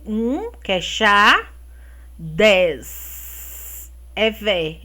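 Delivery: each syllable spelled out slowly; slow syllable reading. Shown as a demonstration. Teacher sounding out syllables for kids, slowly. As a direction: read the syllables one by one, drawn out. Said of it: "formou a palavra chave".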